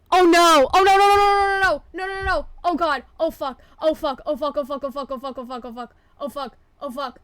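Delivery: real panic after a mistake; panicked. Real, not acted.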